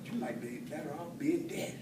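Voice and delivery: usual speaking voice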